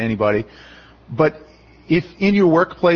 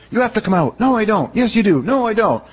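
put-on higher, childish back-and-forth; mock bickering debate; 7 semitones higher than usual. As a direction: play a silly squabble with a demon. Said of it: "long, lengthy debate".

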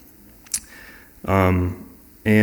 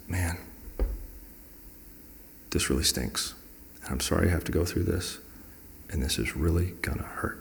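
honest sympathy; 2 semitones lower, 10 dB quieter than usual. Retold or imitated. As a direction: break hard news gently, as a close friend. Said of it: "he was very honest with me".

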